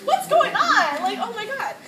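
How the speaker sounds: sudden high, loud panicked cry; reliving the panic, voice shooting up. Retold or imitated. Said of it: "at that point, I start crying".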